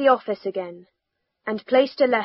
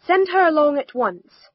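higher-pitched, urgent order; eager cry; 5 semitones higher, 4 dB louder than usual. A narrator's reading. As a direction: press for immediate action. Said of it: "cried Ellington".

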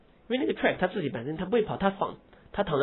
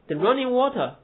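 running and water both stressed, water falling; both words stressed; 8 semitones higher, 3 dB louder than usual. Shown as a demonstration. Teacher showing students the participle phrase, even stress. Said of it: "这是现在分词吗".

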